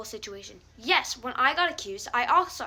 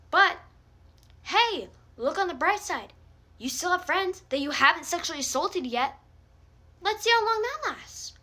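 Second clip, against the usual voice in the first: sarcastic mock cheer; 5 semitones higher than usual. Real, not acted.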